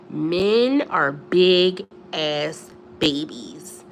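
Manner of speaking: drawn out, stress on Men and babies; slow emphasis. Real, not acted. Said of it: "lecturing young girls".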